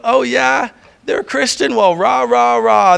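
mock the believers with a jeer; the accuser sneering at Christians to God; mocking taunt. Mocking accuser voicing a challenge.

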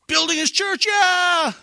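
high, loud mock hype; mock cheer. Pastor mimicking churchgoers' easy cheer, high and loud. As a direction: ham up the easy hallelujah. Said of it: "we spend a lot of time saying".

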